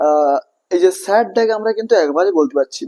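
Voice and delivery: ordinary delivery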